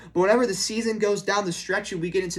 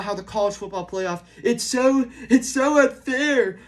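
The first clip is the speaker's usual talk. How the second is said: higher, louder, slow mocking whine; mock whining coach; 4 semitones higher, 3 dB louder than usual. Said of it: "talking for five straight minutes".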